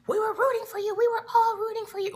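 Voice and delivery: imitating Tyra's disappointed scolding; Tyra Banks impression. Vlogger mimics Tyra Banks scolding a contestant, high and strained. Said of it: "compared it to the picture of Tyra".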